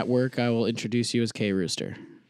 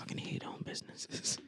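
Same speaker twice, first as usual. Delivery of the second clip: whisper; 18 dB quieter than usual. Pretend. Whispered joke.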